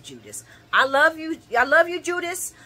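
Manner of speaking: ordinary talk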